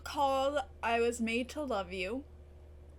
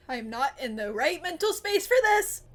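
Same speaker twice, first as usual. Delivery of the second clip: louder distressed exclaim; overwhelmed outburst; 2 semitones higher, 8 dB louder than usual. Real, not acted. Viewer overwhelmed after a death scene, loud outburst. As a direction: protest at the screen, rattled.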